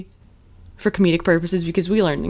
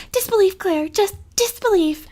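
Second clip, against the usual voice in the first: mock disapproving mother; 13 semitones higher than usual. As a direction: stretch it out like a shocked snooty mom.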